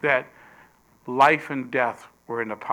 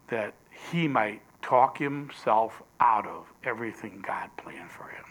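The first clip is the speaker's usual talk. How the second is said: a low, hushed whisper, slowing into weight; solemn whisper; 4 semitones lower, 7 dB quieter than usual. Acted. Preacher sealing the lesson on the tongue, in a low whisper. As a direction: breathe the verse out like a secret.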